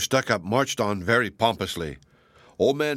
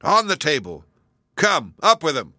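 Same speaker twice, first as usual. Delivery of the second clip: louder, higher urgent command; crowd orders; 4 semitones higher, 5 dB louder than usual. Acted. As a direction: hustle him onto the table. Crowd hauling the prisoner.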